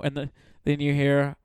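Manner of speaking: normal talking voice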